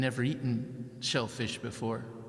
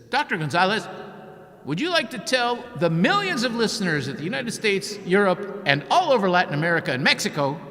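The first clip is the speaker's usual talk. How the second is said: loud, high, booming broadcast challenge; reporter on air; 6 semitones higher, 8 dB louder than usual. Retold or imitated. Reporter shames the doctor into treating her, booming broadcast voice. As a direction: grandstand like a reporter live on the air. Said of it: "And he says".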